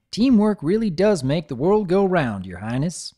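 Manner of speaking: folksy farm pony reading her letter aloud; lightly twangy country pony; Applejack voice. Acted.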